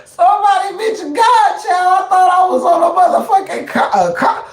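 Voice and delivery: high, teary laughing voice; squeaky, laughing, teary; laughing to tears. Real, not acted. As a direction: talk through real laughter. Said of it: "laugh till I teared up".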